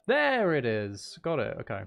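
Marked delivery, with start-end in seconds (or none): none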